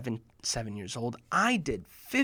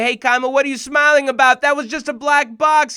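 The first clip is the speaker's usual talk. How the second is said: higher, louder indignant protest; indignant call; 8 semitones higher, 11 dB louder than usual. Retold or imitated. Boy calling out to his grinning friend, indignant. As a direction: call him out. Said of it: "I say".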